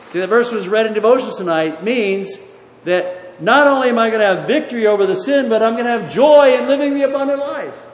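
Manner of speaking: loud, rising preacher's drive on the promise; preaching at full force. Acted. Preacher to congregation, loud and rising fervour. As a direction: build it up and push to the hall. Preaching on victory over sin.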